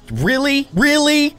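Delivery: high-pitched, disbelieving question; incredulous. Pretend. Cook to cat refusing his potato, incredulous.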